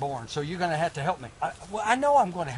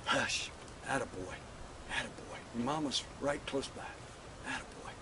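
much softer, low murmur; hushed soothing; 4 semitones lower, 11 dB quieter than usual. Acted. Father murmuring to his sleeping son, very soft. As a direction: murmur so he stays asleep.

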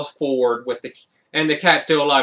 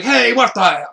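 startled cop; 6 semitones higher, 4 dB louder than usual. Retold or imitated. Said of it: "it's just like".

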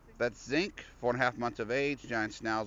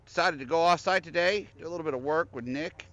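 raised, higher voice over street noise; yelling over leaf blower; 6 semitones higher, 5 dB louder than usual. Real, not acted. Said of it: "Got a leaf blower going off".

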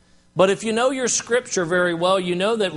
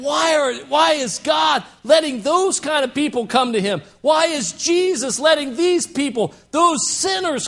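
indignant Pharisees; 8 semitones higher than usual. Retold or imitated. Pharisees object to Jesus receiving sinners, outraged shout.

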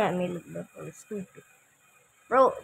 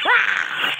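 short loud yelp of fright; a sudden scared yell; startled scream; 5 dB louder than usual. Real, not acted. Sudden fright in horror game.

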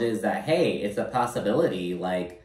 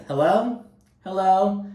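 mock puzzled hello; 8 semitones higher, 3 dB louder, slower than usual. Mimicking answering a butt dial.

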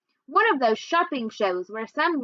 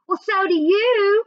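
much higher, louder, slow yell; hollering back; 10 semitones higher, 6 dB louder than usual. A narrator's reading. Toby hollering back at his sister, high and loud. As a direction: fling the taunt back, loud and drawn out. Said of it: "Toby hollered back".

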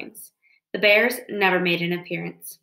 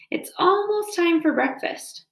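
sly fox; 10 semitones higher than usual. A narrator's reading. Fox hints slyly.

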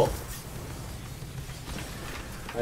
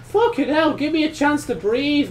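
exasperated plea; 12 semitones higher than usual. Real, not acted. Overwhelmed by enemies in game.

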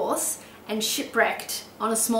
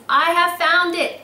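much higher and louder, slow triumphant cry; excited exclamation; 8 semitones higher, 7 dB louder than usual. Acted. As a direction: shout it like a eureka moment. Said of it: "exclamation point".

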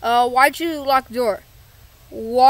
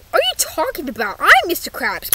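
usual speaking voice

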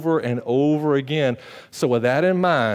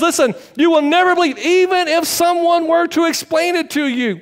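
higher, louder warning; preacher voicing Paul, raised; emphatic warning; 5 dB louder than usual. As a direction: press it home hard. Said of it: "And he says".

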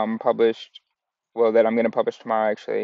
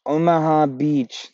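"Omaha Beach" slightly higher and slow, a hint of the put-on accent; lighter mock accent; 5 semitones higher, slower than usual. Shown as a demonstration. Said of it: "I don't know what that accent was".

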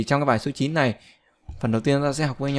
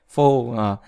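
usual speaking voice

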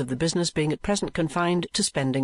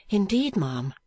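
mildly timid, higher voice; 6 semitones higher, slower than usual. A narrator's reading. Young niece answering the madam, timid. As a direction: answer shyly, pitched up. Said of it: "replied Kate timidly".

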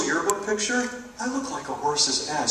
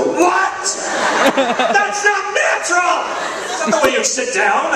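much higher, louder slow protest; indignant shout; 12 semitones higher, 5 dB louder, slower than usual. Real, not acted. Comic to photographer, indignant shout. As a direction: bellow the outrage.

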